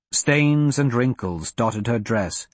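ordinary delivery, nothing marked

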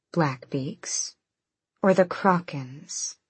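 soft teasing purr. Acted. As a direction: purr the question back lightly. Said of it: "Aylin purred".

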